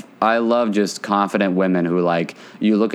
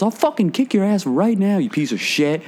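high-pitched mock fury; imitated angry threat; 10 semitones higher than usual. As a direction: threaten him in a heated, put-on high voice. Retold threat in a quarrel.

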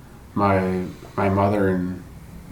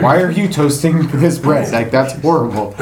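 higher, louder mock-indignant gripe; childish complaint; 6 semitones higher, 8 dB louder than usual. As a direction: whine about the toasted bread like a cranky kid. Kid griping at grandpa's toast.

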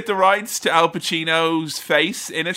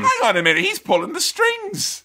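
mock sudden realisation; 13 semitones higher than usual. Player spotting who pulls the strings, mock astonishment. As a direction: pitch leaping high on the reveal. Acting out realising during the game.

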